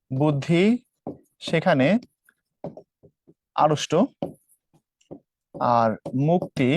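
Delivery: phrase by phrase with long pauses; slow dictation. Shown as a demonstration. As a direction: dictate it slowly, pausing after each chunk. Dictates the slogan for students.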